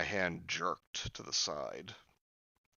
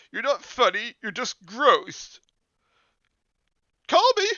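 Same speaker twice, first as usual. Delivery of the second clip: angry; 12 dB louder than usual. Acted. Julie still yelling.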